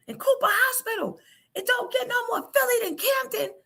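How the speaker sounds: high mock kid voice; son insisting. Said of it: "what he say".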